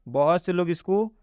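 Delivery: normal talking voice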